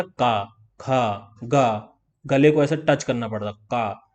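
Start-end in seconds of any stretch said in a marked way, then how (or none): 0.0-2.0 का, खा, गा drawn out very slowly; slow throat sounds. Shown as a demonstration. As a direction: stretch का, खा, गा from the closed throat. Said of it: "गले से क्या आ रहा था". (गले से बहुत धीरे का, खा, गा)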